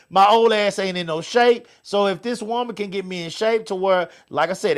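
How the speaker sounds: ordinary talk, nothing marked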